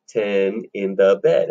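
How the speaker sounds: TEN IN THE BED slow, each word separate; syllable by syllable. Shown as a demonstration. Teacher counting four syllables, slow and separated. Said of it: "has four syllables".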